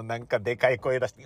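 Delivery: usual speaking voice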